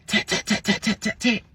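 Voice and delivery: high, clipped knock sounds; imitated tapping. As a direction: tap out the knocks high and quick. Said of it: "you hear the tap at the window".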